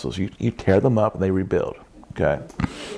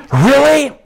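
amazed disbelief; 8 dB louder than usual. Retold or imitated. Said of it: "people seeing the scars".

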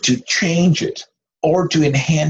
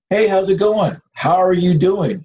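bright, higher cheery hello; cheery greeting; 6 semitones higher than usual. Retold or imitated. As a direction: greet someone breezily at school. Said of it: "we greet people".

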